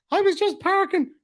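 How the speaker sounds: high-pitched imitation of an innocent driver; mimicked little-old-lady excuse; mock old lady. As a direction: plead innocence in a put-on high voice.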